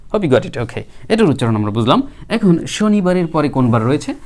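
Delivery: ordinary talk, nothing marked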